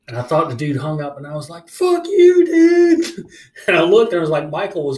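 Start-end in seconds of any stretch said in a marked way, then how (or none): 1.7-3.2 high, loud reenacted yell; acted-out angry shout; raging. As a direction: yell it as if cursing someone who hung up. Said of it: "I mean, I was raging".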